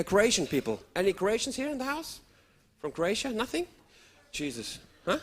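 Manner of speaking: normal talking voice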